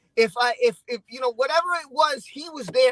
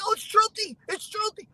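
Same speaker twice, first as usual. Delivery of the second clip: higher-pitched mock fan chatter; fans' cry in a higher voice; imitated fans; 6 semitones higher than usual. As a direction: imitate viewers spotting someone they know, voice raised. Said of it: "people were like".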